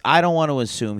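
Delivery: ordinary talk, nothing marked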